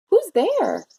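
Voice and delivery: high-pitched mouse calling a question; mouse crying out. A narrator's reading. Mouse calling to whoever is at the den, high cry. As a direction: pipe up high as a startled little mouse. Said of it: "and the mouse cries".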